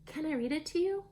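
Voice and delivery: put-on high, hopeful child's question; eager call. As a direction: ask loudly, hoping for a yes. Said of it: "she called".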